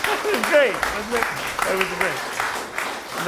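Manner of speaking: high, loud, beaming praise; excited praise. Real, not acted. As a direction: gush over the singer. Praise after a sung performance.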